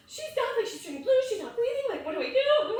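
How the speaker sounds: pleading frantically down the phone; panicked. Retold or imitated. Mom frantically telling the emergency operator her child isn't breathing, panicked. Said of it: "And I'm panicking".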